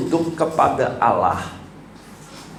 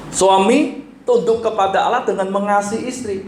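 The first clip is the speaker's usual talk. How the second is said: raised, driving preacher's voice; preaching at full force; 9 semitones higher than usual. Real, not acted. Preacher urging husbands to love their wives, at full force. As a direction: press the point on the congregation.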